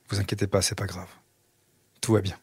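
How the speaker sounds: low, soft, with gaps between phrases; hushed, comforting father; quiet reassurance. Retold or imitated. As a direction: reassure your children while holding back emotion. Father reassuring sons while moved.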